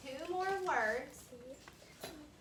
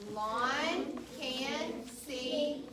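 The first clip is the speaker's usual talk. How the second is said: "Lawn can see the hot pot" read very slowly, word by word; teacher leading the class to read a sentence; slow choral reading; 3 dB louder, much slower than usual. Shown as a demonstration. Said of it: "try to read this page".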